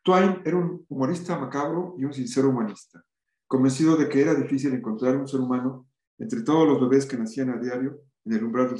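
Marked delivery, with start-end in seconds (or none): none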